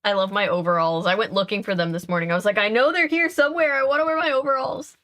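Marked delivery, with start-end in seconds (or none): 2.6-5.0 excited. Retold or imitated. Self quoted, eager about overalls.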